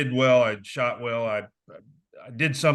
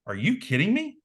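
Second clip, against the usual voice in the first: higher-pitched disbelieving question; stunned disbelief; 7 semitones higher than usual. Retold or imitated. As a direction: pitch it up in shock. The world stopped at news.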